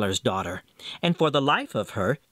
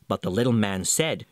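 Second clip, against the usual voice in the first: plain reading voice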